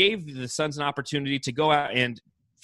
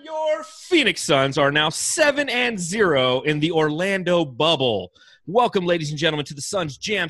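announcer intro; 4 semitones higher, 4 dB louder than usual. Acted. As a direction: draw it out like an arena announcer.

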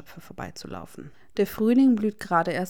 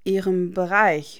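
"Ihrem Bereich" drawn out slowly, a bit louder; slow mocking repeat; 6 dB louder, slower than usual. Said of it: "wiederholt Regulus langsam". („Ihrem Bereich“ langsam und ungläubig wiederholt)

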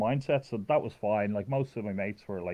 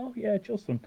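much higher-pitched "ah, now I remember"; sudden recognition; 13 semitones higher than usual. Retold or imitated. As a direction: act out the penny dropping, voice jumping up high. Acts out suddenly remembering Justin.

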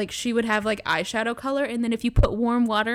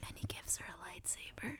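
whispering; 21 dB quieter than usual. Real, not acted. Whispered, unvoiced.

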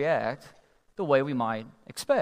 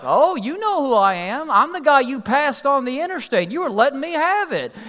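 loud, high-pitched accusation; angry trucker; 10 semitones higher, 7 dB louder than usual. Acted. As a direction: confront him as the offended big trucker. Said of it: "he's all mad".

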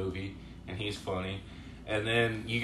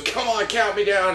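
higher and louder insistence; mock command; 11 semitones higher, 8 dB louder than usual. Retelling a comedy scene, higher, louder mock insistence. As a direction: push it impatiently. Retelling a movie character's lines.

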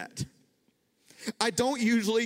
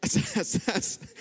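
laughing. Real, not acted. Laughing as he retells.

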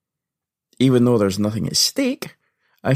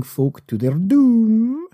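much higher, slow, hammed-up vampire lure; Bela Lugosi; 12 semitones higher, much slower than usual. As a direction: draw the words out like an old film vampire. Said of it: "Bela Lugosi doing that weird thing".

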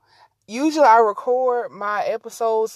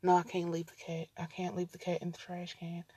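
normal talking voice

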